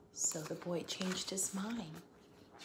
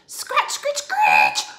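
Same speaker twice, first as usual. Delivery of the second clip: loud, very high scratchy screech; loud scratching screech noise; 18 dB louder, much slower than usual. Acted. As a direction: screech like a branch on glass. Scary noise at window.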